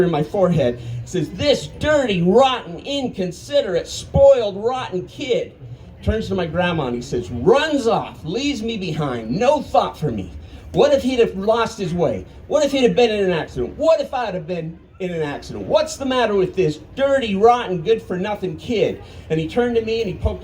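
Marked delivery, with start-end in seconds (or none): 1.3-5.5 high, biting string of insults; furious scolding. Retold or imitated. Grandpa scolding the boy to his face, high and furious. 10.7-19.0 angry rant. Retold or imitated. Grandpa ranting to grandma.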